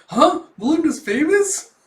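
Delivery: loud, high burst of surprise; astonished. Acted. Yor learning the woman is famous, loud astonishment. Acting Yor's astonished reaction.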